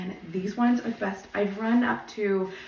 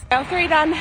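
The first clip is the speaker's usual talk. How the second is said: higher, louder proud shout; triumphant call-out; 6 semitones higher, 7 dB louder than usual. Real, not acted. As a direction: celebrate finishing the mile. Calling out mile while running.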